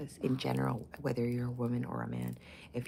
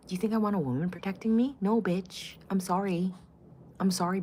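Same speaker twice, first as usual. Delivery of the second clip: usual speaking voice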